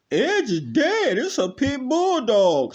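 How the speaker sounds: mocking a critic who says edge bloodlines are dead. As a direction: mimic a know-it-all critic, squeaky and slow.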